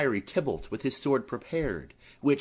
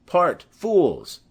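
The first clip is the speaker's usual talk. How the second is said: louder, higher commanding cry; shout to part fighters; 5 semitones higher, 7 dB louder than usual. Acted. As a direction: bark the order to stop the fight. Benvolio parting the fighters.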